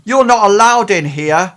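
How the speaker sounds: high, loud challenging guard voice; suspicious guard. Acted. A security guard confronts the twin by the bed, high and loud. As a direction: demand it like a guard who caught an intruder. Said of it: "He says".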